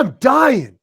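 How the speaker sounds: high, loud, slow excited yell; a retold wrestler yelling in delight; fired up. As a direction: shout it like a thrilled fan. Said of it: "He was all fired up".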